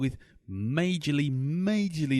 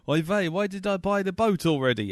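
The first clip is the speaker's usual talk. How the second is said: high, lightly hammed Yiddish accent; 8 semitones higher, 3 dB louder than usual.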